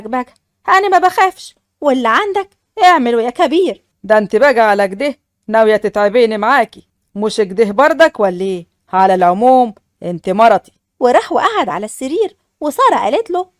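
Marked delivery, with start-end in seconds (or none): 4.0-10.7 a lower, firmer man's voice for the husband; husband's voice. Acted. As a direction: speak as the stern new husband. Fares threatens his bride. (صوت الزوج الحازم بنبرة أخفض)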